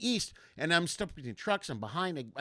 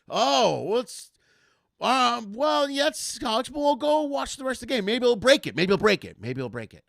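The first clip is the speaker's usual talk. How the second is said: awkward consoling; 7 semitones higher, 5 dB louder than usual. Retold or imitated. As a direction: cheer him up, then trail away. Brother awkwardly consoles, fading out.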